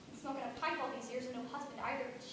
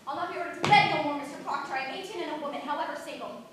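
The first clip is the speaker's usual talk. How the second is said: much louder, indignant protest; defiant outburst; 9 dB louder than usual. Acted. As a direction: stand up to him loudly. Servant defies master.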